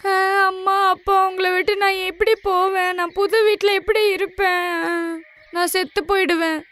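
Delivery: high, hammed-up wailing like a child; fake crying. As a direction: overact a childish sob to fool the parents. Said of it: "ராணி குழந்தை மாதிரி ரொம்பவே அழுக ஆரம்பிச்சிட்டா". (மிகைப்படுத்திய போலி அழுகை)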